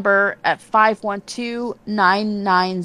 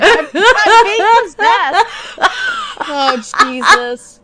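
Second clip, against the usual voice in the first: laughing hysterically; 13 semitones higher, 6 dB louder, slower than usual. Real, not acted. Mock possession, laughing hard.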